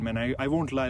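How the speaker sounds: ordinary talk, nothing marked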